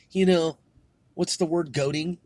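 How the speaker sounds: ordinary talk, nothing marked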